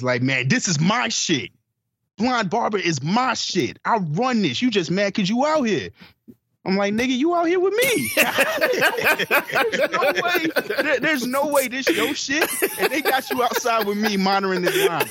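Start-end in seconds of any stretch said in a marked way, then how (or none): 0.2-5.9 upset bouncer. Retold or imitated. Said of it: "yeah, he was upset". 6.9-8.1 mock outburst. Retold comeback to bouncer. 8.1-15.1 laughing through the words, a bit fast; can't keep a straight face telling it. Real, not acted. Telling friends about the bouncer, laughing.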